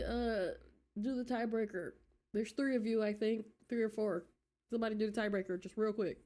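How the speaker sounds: ordinary delivery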